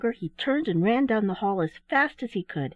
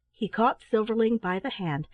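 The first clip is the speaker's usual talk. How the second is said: ordinary delivery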